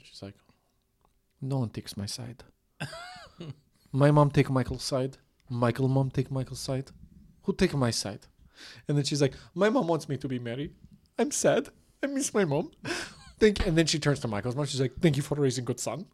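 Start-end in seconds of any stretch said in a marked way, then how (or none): 1.4-8.3 put-on comic Ukrainian accent, complaining; broken English in a put-on Ukrainian accent. Bride feeling nobody sides with her, Ukrainian accent. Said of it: "She talks like this". 9.5-13.7 high-pitched mock Ukrainian accent. Bride missing her mom, high comic Ukrainian accent. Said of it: "She talks like this". 15.0-16.1 put-on Ukrainian accent; comic Ukrainian accent, rising at the end. Bride thanking [name]'s mom, Ukrainian accent. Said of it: "She talks like this".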